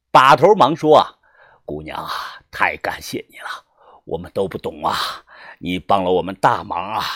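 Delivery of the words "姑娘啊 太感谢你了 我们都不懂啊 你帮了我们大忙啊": very low, soft character voice; low-voiced, hushed elder giving thanks; older leader. A narrator's reading. Gang leader thanking the girl for helping, low soft voice.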